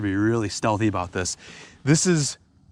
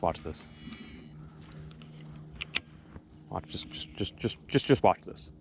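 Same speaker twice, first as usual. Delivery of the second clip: hushed; 3 semitones lower, 10 dB quieter than usual. Real, not acted. Whispering before a cast.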